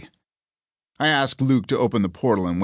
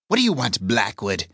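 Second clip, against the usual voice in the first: snarled demand. A narrator's reading. Demon grabbed by the arm confronts her hunter, snarling demand. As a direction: snarl it as an irritated challenge. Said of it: "and snarls at me".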